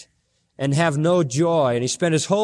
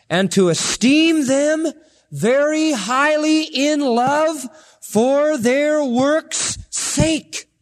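preaching at full force; 11 semitones higher, much slower than usual. Acted. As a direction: stretch the verse out, full pulpit cry.

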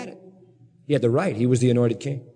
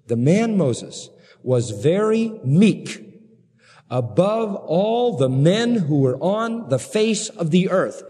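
slow, leaning hard on very; preacher slowing down for a key verse; slow emphatic reading; 4 semitones higher, much slower than usual. Shown as a demonstration. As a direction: stretch out the verse word by word. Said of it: "get this line".

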